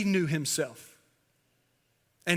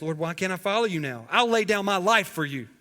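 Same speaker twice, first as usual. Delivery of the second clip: earnest vow; 3 semitones higher, 4 dB louder than usual. Retold or imitated. Peter pledging his life to Jesus, fervent. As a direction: build from pleading question to bold pledge.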